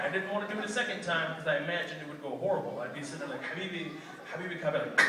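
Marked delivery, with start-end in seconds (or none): 3.5-4.6 softly offered Habibi; small, quiet mock plea; meek attempt. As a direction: try Habibi timidly on a cop. Imagines meekly retrying his trick.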